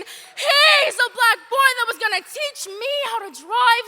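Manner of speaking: loud and high, pushing every line; impassioned defense. Acted. Defending her uncle to those who dismiss him, loud passion. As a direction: fight for him with your voice.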